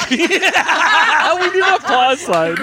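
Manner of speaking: talking through real laughter; words broken up by laughs; laughing. Real, not acted. Hosts break up laughing.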